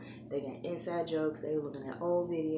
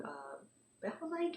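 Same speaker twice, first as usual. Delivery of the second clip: normal talking voice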